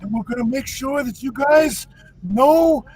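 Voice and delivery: lightly voiced Italian mobster accent, hushed; half-joking wise-guy Italian accent; mock Italian gangster. Host teasing co-host about Italian cooking in mock Italian gangster accent. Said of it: "Yeah, jokes".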